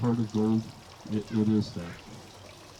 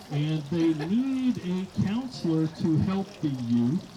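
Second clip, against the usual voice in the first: ordinary talk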